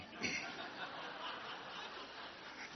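a high, breathy burst of laughter; high breathless laugh. Real, not acted. Preacher laughing at his own joke, airy high laugh.

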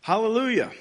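ringing scripture proclamation; crying out. A narrator's reading. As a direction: call it out to the heavens. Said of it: "Once more they cried out".

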